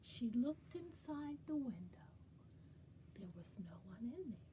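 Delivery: quick conspiratorial whisper. Acted. Storyteller teasing children, whispered. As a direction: let them in on a secret.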